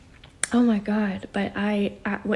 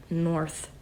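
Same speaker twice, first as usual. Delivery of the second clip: ordinary talk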